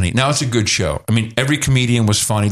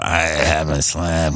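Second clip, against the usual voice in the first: put-on tired old complaint; 8 semitones lower, 3 dB quieter, much slower than usual. Roseanne complaining, mimicked.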